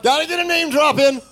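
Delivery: high, loud hyped shout; mock excited. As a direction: crow it like a proud name-dropper. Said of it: "asking me to ask my good friend".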